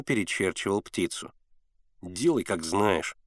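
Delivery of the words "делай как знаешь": low, slow grumble; sulky low grumble; grumbling. A narrator's reading. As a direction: give in grudgingly, dragging the words. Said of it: "проворчал Генка".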